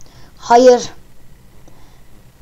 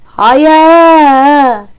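loud drawn-out no; frustrated no; 5 semitones higher, 9 dB louder than usual. Real, not acted. Fed-up player answering the game's questions, loud drawn no. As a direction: groan the no out in annoyance.

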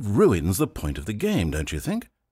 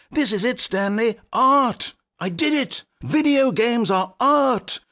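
triumphant; 12 semitones higher than usual. Acted. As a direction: cry out in mock triumph. Narrator triumphant at his invention.

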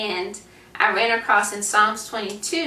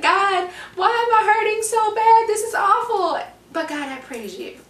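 hammed-up cry of pain turning to praise; imitating anguished psalmist; 11 semitones higher, 4 dB louder than usual. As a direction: play up the psalmist's wailing. Voicing psalm writers crying out.

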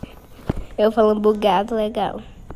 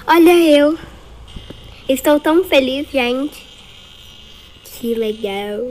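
ordinary talk

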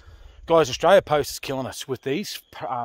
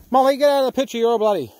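calling out; 9 semitones higher, 6 dB louder than usual. Real, not acted. Calling off dog from camera shot.

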